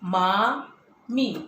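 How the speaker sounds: मामी stretched very slowly; मामी with a long ee at the end; long drawn-out vowel. Shown as a demonstration. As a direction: hold each vowel of मामी, lingering on mee. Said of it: "आपला दीर्घ उच्चार झाला मीचा".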